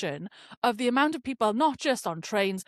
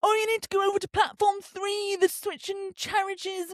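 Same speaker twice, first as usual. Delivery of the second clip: high, sing-song mimicry of staff; railway worker's voice; 9 semitones higher than usual. Railway worker sending passenger to platform three, mock officious call. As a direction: imitate an official, high and dragging out.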